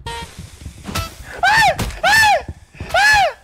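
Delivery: piercingly high shrieks; quick high screams, one after another; short screams. Pretend. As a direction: series of loud panicked squeals. Screaming in game.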